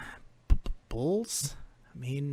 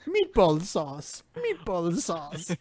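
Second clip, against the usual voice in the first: a pompous gentleman in a monocle; sophisticated meatball; 11 semitones higher, 4 dB louder than usual. Acted. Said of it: "So I'm a sophisticated meatball".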